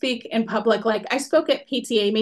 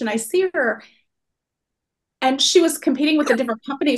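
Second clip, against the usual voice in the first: usual speaking voice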